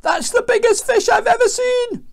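very high, loud squeal; acted panicked yell; alarmed wail. A narrator's reading. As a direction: cry out in shock at a monster. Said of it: "Jonah groaned".

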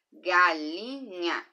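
"Galinha" as "ga-li-nha", slow; syllable-clapped. Shown as a demonstration. Teacher showing three syllables, slowly. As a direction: draw "Galinha" out in three beats. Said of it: "palavra com três pedacinhos".

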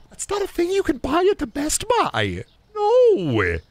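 high, disbelieving retort; high incredulous no; exasperated. Real, not acted. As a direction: squeak out the refusal in disbelief. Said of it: "exasperated retort".

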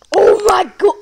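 sudden frightened shout; startled yell. Real, not acted. Jump scare in video.

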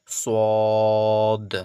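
সদ loud with a long rounded vowel; drawn-out. Shown as a demonstration. Teacher explains madd, সদ drawn out long. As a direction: stretch সদ steadily before the final d. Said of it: "টেনে টেনে পড়তে হচ্ছে".

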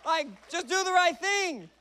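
loud, high-pitched comic outburst; exasperated preaching rant. Acted. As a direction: yell it in mock outrage at the whole crowd.

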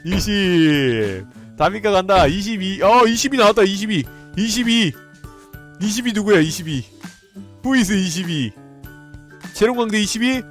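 high loud roulette hype; excited. Real, not acted. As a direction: call out the result thrilled. (흥분한 외침)

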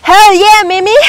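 loud, high cheer of a yes; hyped answer. Shown as a demonstration. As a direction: shout it like a pumped-up class. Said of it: "There's only one answer, and it's".

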